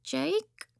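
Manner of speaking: put-on high small girl's voice calling out, rising; a sleepy little sister looking for her brother. A narrator's reading. Little sister calling from the porch for her brother, high and small.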